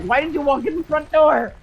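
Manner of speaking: high, baffled question. Retold or imitated. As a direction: ask in disbelief. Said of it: "And it's like".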